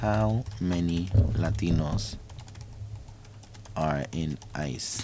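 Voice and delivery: slow, soft, low, spaced-out words; absent-minded murmur. Real, not acted. Looking something up mid-show, absent-minded murmur. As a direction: murmur it slowly as you type. Mumbles while typing a search.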